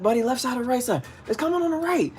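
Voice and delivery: high, hurried flustered questions. Retold or imitated. Angler asking boater where the fish comes, flustered and high. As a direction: scramble with the net, flustered.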